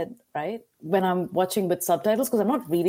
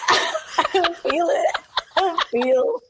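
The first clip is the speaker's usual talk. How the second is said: much higher, slow and laughing refusal; mock-whiny I don't feel it; reluctant, joyless; 10 semitones higher, slower than usual. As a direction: drag it out, laughing, unwilling to fake joy. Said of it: "a little bit more, like, joy".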